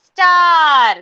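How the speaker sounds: "Star" called out loud and drawn out; word demonstration. Shown as a demonstration. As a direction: stretch "Star" long and loud so children hear its sounds. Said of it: "now I'm going to tell you the name".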